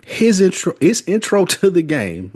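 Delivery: high-pitched, barely keeping a straight face; holding back laughter. Real, not acted. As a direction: try to get it out without cracking up. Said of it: "I can't even get this out without laughing".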